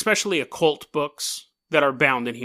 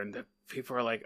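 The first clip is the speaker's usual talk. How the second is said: usual speaking voice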